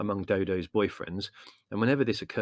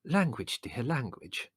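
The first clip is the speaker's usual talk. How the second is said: high, mild motherly chiding; mild singsong reproach; automatic reproof; 4 semitones higher, slower than usual. A narrator's reading. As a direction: chide lightly out of habit. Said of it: "said Mother automatically".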